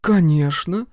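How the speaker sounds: high, brazen yes; cheeky. A narrator's reading. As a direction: toss it back insolently. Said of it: "нагло ответила Машка".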